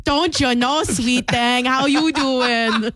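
hammed-up Minnesota come-on; mock Minnesota accent. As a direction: flirt jokingly in a thick Minnesota accent. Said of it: "having a lot of fun with the Minnesota accent".

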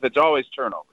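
ordinary talk, nothing marked

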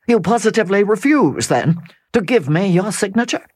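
Sir Percival pressing. Acted. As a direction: push her hard for an answer.

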